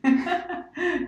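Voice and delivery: bright high laugh; laughing with her guests. Real, not acted. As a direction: laugh along at the joke. Host laughs along.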